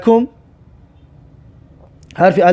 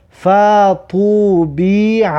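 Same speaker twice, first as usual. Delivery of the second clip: slow syllables with held vowels; drawn-out model reading; much slower than usual. Shown as a demonstration. Teacher sums up how learners should read, slow and drawn out. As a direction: stretch "فطوبیع" syllable by syllable.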